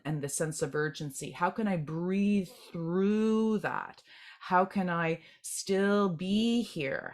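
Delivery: very slow, drawn-out question; slowed-down musing. Retold or imitated. Meditator asking how to sit longer, very slow. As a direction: stretch each word as if settling in.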